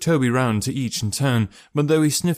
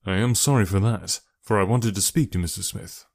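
ordinary delivery, nothing marked

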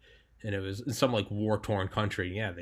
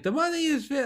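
attempted Israeli accent. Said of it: "I can't do an Israeli accent".

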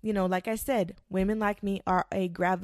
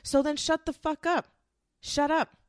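higher, louder snap; fed up; 8 semitones higher, 4 dB louder than usual. Real, not acted. To the friend who keeps whining, an exasperated shut up. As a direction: cut them off, done listening.

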